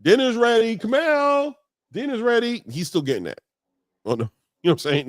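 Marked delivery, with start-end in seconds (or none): 0.0-2.6 yelling across the room. Retold or imitated. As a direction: call the kid down to dinner. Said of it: "getting a "dinner is ready, come downstairs" call".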